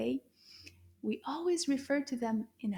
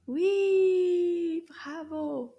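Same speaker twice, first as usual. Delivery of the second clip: excited cheer; 5 semitones higher, 7 dB louder, much slower than usual. Real, not acted. Cheering a right answer.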